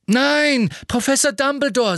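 very high, loud pleading shout; desperate shout. A narrator's reading. Boy shouts to stop the headmaster's confession, loud. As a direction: yell to stop him at any cost.

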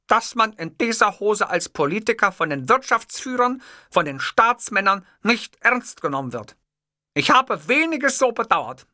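high-pitched, fervent speechifying; impassioned oratory. A narrator's reading. Boss confessing his sacrifice of shorts, impassioned oratory. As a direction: declaim it as a solemn sacrifice.

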